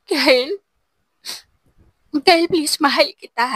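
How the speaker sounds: high, pleading plea of love; cling to him through tears; tearful pleading. A narrator's reading. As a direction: heartbroken begging. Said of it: "habang lumuluha".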